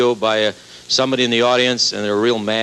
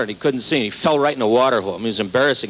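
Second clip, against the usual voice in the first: ordinary delivery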